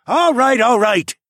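loud, high call for order; silencing a crowd. A narrator's reading. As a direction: call over the noise to settle them. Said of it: "held his hands up to silence the crowd".

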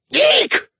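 high squealing eek; shrill startled yelp; feral yelp. Acted. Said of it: "let out a feral yelp".

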